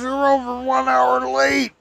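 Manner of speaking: high, slow scolding preacher; stern preacher voice. Retold or imitated. Narrator imitating the preacher scolding him for lateness. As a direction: mimic the preacher's disapproving rebuke.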